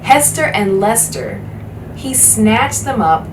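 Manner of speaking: usual speaking voice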